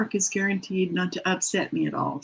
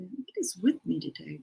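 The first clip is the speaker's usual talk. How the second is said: ordinary delivery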